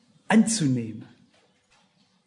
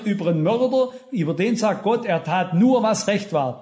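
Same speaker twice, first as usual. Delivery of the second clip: raised, driving sermon delivery; fervent preaching; 4 semitones higher, 3 dB louder than usual. Acted. Preacher urging the congregation to live now, fervent. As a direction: press the point home to the congregation.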